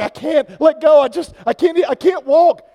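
high, loud panicked pleading; cling on in a panic, voice up high; scared kid. Retold or imitated. Scared young man on the roof begging, high and loud. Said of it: "the guy was like".

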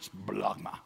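"splagma" pushed up from down in the gut. Shown as a demonstration. Preacher showing the sound of the Greek word, gut-level "splagma". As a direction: let "splagma" come from the belly. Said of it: "came from right down here".

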